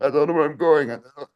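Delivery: imitating a doddering Biden; mock Biden. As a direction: wander off mid-thought like a lost old man. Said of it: "we don't have to discuss Biden, the actor".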